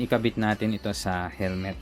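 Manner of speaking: ordinary talk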